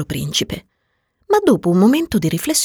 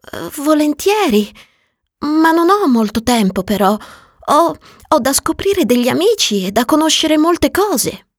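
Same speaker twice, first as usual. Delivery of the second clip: put-on high, louder boyish voice; much higher young prince voice; little prince voice; 11 semitones higher, 5 dB louder than usual. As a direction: answer eagerly like a busy child. Prince answers fox eagerly.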